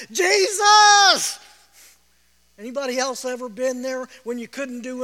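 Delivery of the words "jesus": desperate shout to Jesus; cried out. Retold or imitated. Sinking Peter crying out to Jesus, desperate shout. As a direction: cry out for help as if sinking. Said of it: "he cried out".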